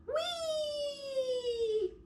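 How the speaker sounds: a tiny joyful squeal of thrill; gleeful high squeal. Acted. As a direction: squeal Whee way up high but soft. Joyful ride from branch to branch.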